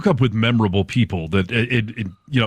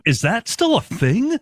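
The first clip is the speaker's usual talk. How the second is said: incredulous; 13 semitones higher, slower than usual. Retold or imitated. Incredulous quote at phone sex ad.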